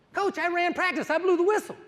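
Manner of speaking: high-pitched, proud youngster's voice; proud kid. Retold or imitated. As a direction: imitate a boy announcing his big job. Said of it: "He goes".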